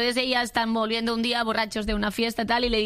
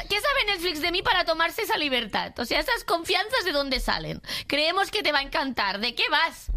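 much higher, affronted complaint; indignant rant; 6 semitones higher than usual. Real, not acted. Guest complaining that Netflix presumes to know her, high affronted rant. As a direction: scold an app like a cheeky friend.

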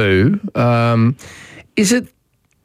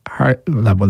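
normal talking voice

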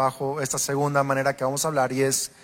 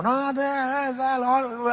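high, emphatic mock protest; hammed-up family back-and-forth; mock loud no-no-no; 8 semitones higher, much slower than usual. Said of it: "hablar así". (un "no, no, no" fingido y agudo)

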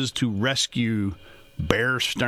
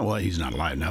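ordinary talk, nothing marked

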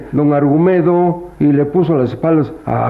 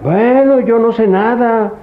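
agudo, desentendiéndose; a la defensiva; 9 semitones higher, slower than usual. Acted. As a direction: sube la voz y lávate las manos. Se desentiende ante la protesta. (tajante y desentendido)

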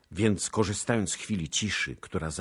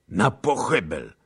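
short, loud, raised call for death; shouted cry; 4 semitones higher, 8 dB louder than usual. A narrator's reading. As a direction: cry it out to rouse the others. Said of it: "krzyknął". (donośny okrzyk)

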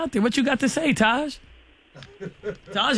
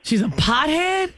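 slow, higher-pitched disbelieving question; incredulous echo; 4 semitones higher, slower than usual. Real, not acted. Host repeating a caller's claim about his date, incredulous. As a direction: draw it out in disbelief.

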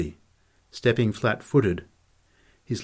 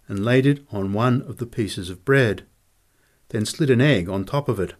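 ordinary delivery, nothing marked

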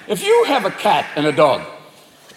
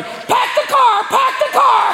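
very high, slow parrot squawk; much slower than usual. Acted. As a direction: screech out a warning like a parrot. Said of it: "if your parrot starts going".

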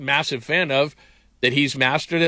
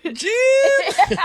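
very high, strained falsetto call; hammed-up squealing sportscaster impression; Tony Romo; 4 dB louder than usual. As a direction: squeak the name out as a parody commentator. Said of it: "Tony Romo on air".

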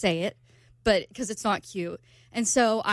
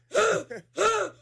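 sharp high gasp, imitating the dog hyperventilating; mock hyperventilating gasp; dog's panting gasp; 10 semitones higher than usual. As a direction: gasp like a panicking puppy. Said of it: "So then he'll go".

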